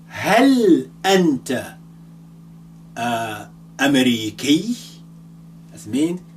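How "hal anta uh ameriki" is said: Hal anta stretched out slowly and louder; slow example question. Shown as a demonstration. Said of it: "so if I say".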